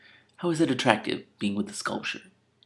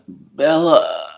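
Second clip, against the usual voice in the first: moaned Bella, higher and louder; sexual moan; 5 semitones higher, 8 dB louder than usual. Shown as a demonstration. Mocking how Edward almost moaned, as a sexual moan. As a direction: moan the name as if in bed. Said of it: "'Cause it would be a sexual one".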